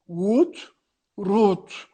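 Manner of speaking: put-on "Ut, rut" clipped in a South African accent; driver's accent. Uber driver repeating "route", South African accent. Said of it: "Aí ele".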